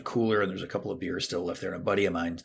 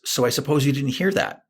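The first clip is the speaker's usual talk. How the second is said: wife's sharp question; 6 semitones higher, 7 dB louder than usual. Retold or imitated. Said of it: "she goes".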